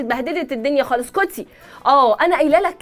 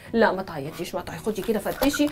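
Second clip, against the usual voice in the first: soothing; 7 semitones lower, 8 dB quieter than usual. Acted. Mother comforts crying daughter.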